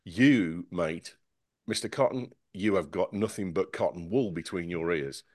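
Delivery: ordinary talk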